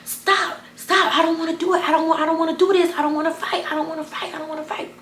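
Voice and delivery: high, begging stop stop; pleading mom. Retold or imitated. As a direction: imitate her begging, fading out. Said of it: "And she like".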